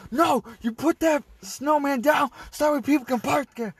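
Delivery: high-pitched mock shouted order; imitated yelling. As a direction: holler across the lot, imitating her. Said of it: "were yelling back".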